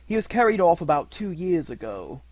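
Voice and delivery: lightly voiced Musgrave telling sad news, pushed loud. A narrator's reading. Musgrave continues.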